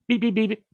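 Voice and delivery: high mock electronic beeps; camera beeps.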